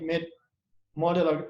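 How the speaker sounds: ordinary talk, nothing marked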